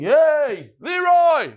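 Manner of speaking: high, loud holler; imitated scream. Scammer calling his accomplice as his mark flees, screaming. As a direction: yell it out like calling someone down the hall. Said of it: "he starts screaming".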